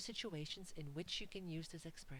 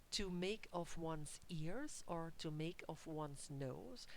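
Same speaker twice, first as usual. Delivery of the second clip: slow literal gloss; slower than usual. Shown as a demonstration. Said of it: "just a literal translation".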